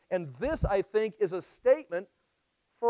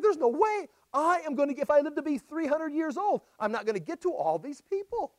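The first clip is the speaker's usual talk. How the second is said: higher-pitched, acted exasperation; overwhelmed disbelief; 7 semitones higher than usual. Jesus looking at the crowds, acted overwhelmed disbelief. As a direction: protest the impossible size of the task.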